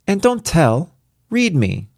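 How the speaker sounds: put-on high, insistent little girl; a small child ordering her father; demanding child voice. A narrator's reading.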